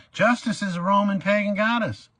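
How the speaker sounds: "Justice" hurled out high and loud; indignant courtroom yell; angry declaration. Retold or imitated. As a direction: shout the line as an accusation. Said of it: "I went off".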